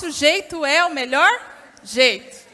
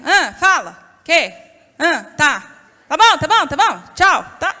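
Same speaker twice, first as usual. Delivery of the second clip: louder, curt brushing-off on the phone; impatient phone answer; 6 dB louder than usual. Retold or imitated. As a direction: get the husband off the line quickly. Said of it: "gente recebendo ligação do marido".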